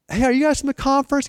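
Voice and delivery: high bright call-out; friendly greeting. Retold or imitated. Pastor greets the young couple, high and friendly. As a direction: call to strangers on the street.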